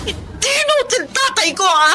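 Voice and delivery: high indignant cry; indignant outburst. Acted. Daughter defending her sick father, high indignant cry.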